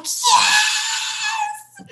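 high, squealed yes of joy; excited yes. Retold or imitated. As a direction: squeal it out with delight. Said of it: "Steph's like".

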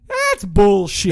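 slowly and firmly, waving off the warning; dismissive scoff. Retold or imitated. Childhood friend brushing off snake warnings, slow mock scoff. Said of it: "So I'm like".

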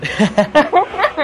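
high laugh. Real, not acted. Laughing at the joke.

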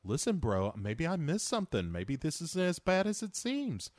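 casual bro impression with swooping pitch. Movie hero rewatching the video log, bro impression. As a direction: play a dim, earnest movie-star bro.

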